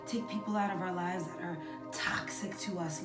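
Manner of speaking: usual speaking voice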